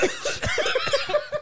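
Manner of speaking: shrieking laugh; high-pitched laughing fit. Real, not acted. Loud laughter.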